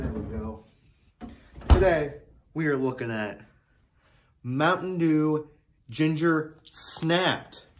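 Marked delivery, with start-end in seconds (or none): 1.7-7.7 louder, slow host announcement with the name stretched out; product announcement. Acted. Host to viewers, slow dramatic product announcement. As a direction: present the soda name like a big reveal, very slowly and higher.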